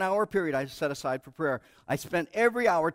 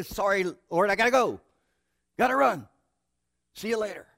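louder, rushing off; hurried goodbye; 5 semitones higher, 6 dB louder than usual. Retold or imitated. Hurried believer leaving prayer early, rushing. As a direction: brush God off on the way out. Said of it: "in a hurry and say".

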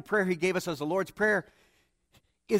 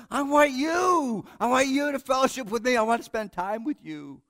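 louder, higher, earnest call; pleading voice of God; 8 semitones higher, 7 dB louder than usual. Acted. God calling after the hurried believer, loud and earnest. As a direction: cry out to be wanted. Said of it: "God says".

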